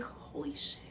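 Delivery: whispered shock, all air; hushed, voiceless astonishment; stunned whisper. Retold or imitated. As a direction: whisper it as a retold realization. Retelling her stunned reaction.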